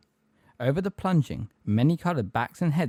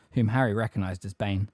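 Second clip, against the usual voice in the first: plain reading voice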